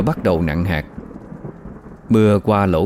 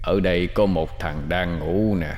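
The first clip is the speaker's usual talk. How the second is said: muttering ghost whisper; 6 dB quieter than usual. Acted. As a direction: mutter to yourself like a lurking ghost. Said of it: "Quay về phía ba cậu".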